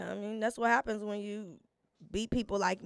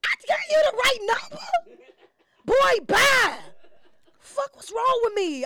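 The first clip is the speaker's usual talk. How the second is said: high, loud laughing cry; laughing hard; 12 semitones higher, 5 dB louder than usual. Real, not acted. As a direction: crack up in disbelief. Laughing at own mistake.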